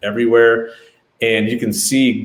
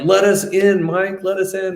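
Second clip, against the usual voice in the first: much higher, insistent begging; pleading call; 11 semitones higher than usual. Retold or imitated. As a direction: keep calling to be let in. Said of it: "we're like".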